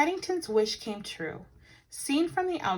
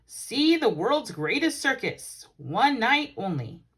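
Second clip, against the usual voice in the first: poster announcement; 3 semitones higher, 5 dB louder, slower than usual. A narrator's reading. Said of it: "across the middle were words".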